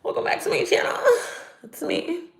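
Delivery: high, whispery fake YouTuber intro; mock a sugary YouTuber greeting. Mocking fake YouTubers, in a hushed sugary intro voice. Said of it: "my little fake YouTuber hang-ons".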